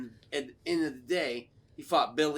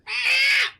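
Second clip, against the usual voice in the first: very high loud mock shriek; piercing imitation monster scream; gremlin screech; 7 dB louder than usual. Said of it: "the gremlin's like".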